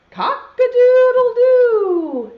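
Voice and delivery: loud, high, drawn-out crow; rooster crow. Acted. Teacher leading children in the rooster's call, crowing. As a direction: crow it slowly and loudly like a rooster. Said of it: "then everyone has to say".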